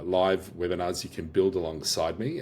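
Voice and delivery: normal talking voice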